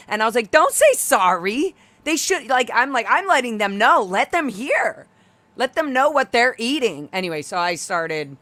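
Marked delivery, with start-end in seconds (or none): 0.5-7.1 raised voice telling diners to listen; loud call-out. Retold or imitated. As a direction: let the whole patio hear. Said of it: "in front of everybody eating there".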